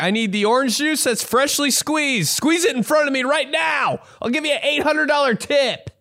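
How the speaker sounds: high, whiny, bossy demand; over-the-top high-pitched entitled customer; demanding sick self. Retold or imitated. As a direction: squeal orders at the juice guy like a spoiled brat. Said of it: "I'm like".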